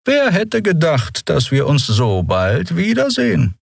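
high, slow, measured irony; dry but sympathetic. A narrator's reading. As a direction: draw it out as a formal captain. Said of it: "trocken, jedoch nicht ohne Sympathie". (hoch, gedehnt, trocken und wohlwollend)

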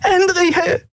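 high, calling across the room; Nelen's high call. A narrator's reading. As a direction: call her name in alarm. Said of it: "hörte sie Nelen rufen".

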